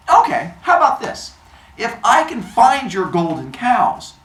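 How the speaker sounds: put-on high, loud kid's proposal; acted scheming youngster; child making deal. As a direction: pitch a bargain like an eager kid. Hermes proposes a deal to Apollo.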